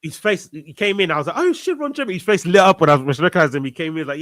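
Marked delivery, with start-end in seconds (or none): 1.4-2.1 high-pitched soft exclamation of surprise; hushed, high "no way" reaction; excited recognition. Retold or imitated. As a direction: retell spotting someone famous, pitched way up. Retelling excited recognition of celebrity.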